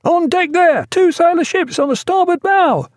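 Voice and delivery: high, loud lookout's cry from the masthead; screaming hail. A narrator's reading. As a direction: bellow it down from aloft. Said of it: "the foremast lookout's screaming hail".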